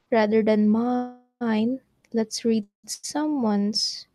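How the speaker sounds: lazy slow announcement; slow drawn-out. Real, not acted. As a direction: stretch the words out slowly.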